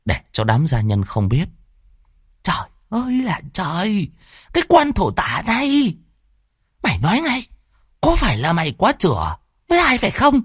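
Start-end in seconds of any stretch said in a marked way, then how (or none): 2.4-10.4 high-pitched, contained outrage; angry mother holding her volume down; hushed hissed scolding. Acted. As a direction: scold sharply but keep it from the servants. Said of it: "Bà rít lên, nhưng giọng cũng khìm".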